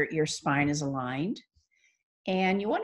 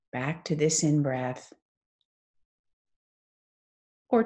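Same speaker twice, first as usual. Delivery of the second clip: guided meditation. Acted. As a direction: ease them into stillness, phrase by unhurried phrase.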